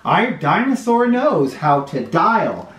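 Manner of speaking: put-on lively storybook read-aloud for little kids; sing-song picture book reading; reading to children. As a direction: read it like story time on the rug.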